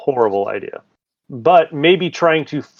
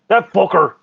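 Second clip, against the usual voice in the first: put-on "that fucker" slipping into a loose, higher Scottish accent; drifting Scottish; 6 semitones higher, 4 dB louder than usual. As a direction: let a mock Scottish burr creep into "that fucker". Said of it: "now I'm turning Scottish".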